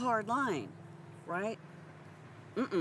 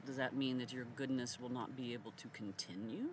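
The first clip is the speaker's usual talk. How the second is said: ordinary talk, nothing marked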